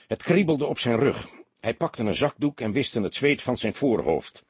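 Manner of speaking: ordinary delivery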